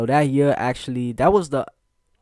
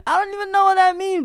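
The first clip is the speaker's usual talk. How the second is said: much higher and louder, squeaky confusion; baffled outburst; 7 dB louder, faster than usual. Retold or imitated. Boy accused of harassment protests, high and baffled. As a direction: shoot up into a high disbelieving yelp. Quoting his confused self.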